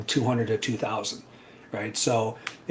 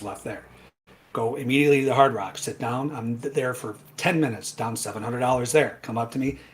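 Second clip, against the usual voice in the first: normal talking voice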